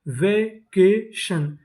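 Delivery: Vacation very slow as वे, के, शन; syllable by syllable. Shown as a demonstration. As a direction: sound out vacation with gaps between syllables. Teacher sounding out new word.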